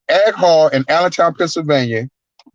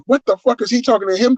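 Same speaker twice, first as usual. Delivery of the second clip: mock disbelief; 8 semitones higher than usual. Crowd reaction retold.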